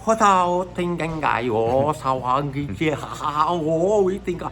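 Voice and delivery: melodic up-and-down mock Chinese gibberish; sing-song fake Chinese, drawn out. Shown as a demonstration. Comedian demonstrates how Chinese sounds, melodic mock Chinese. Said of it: "It's up and down".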